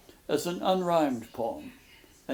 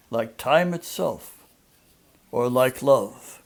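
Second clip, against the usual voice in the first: put-on louder, solemn reading of an old prose sonnet; poem reading; 6 dB louder than usual. Lecturer reads Emerson's prose sonnet to the woods, solemn and louder. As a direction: address the woods with reverent weight.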